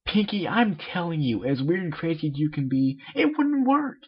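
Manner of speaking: a sporty pony losing patience, voiced high; high-pitched cartoon pony insisting it won't work; Rainbow Dash voice.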